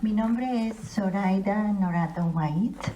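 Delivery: ordinary delivery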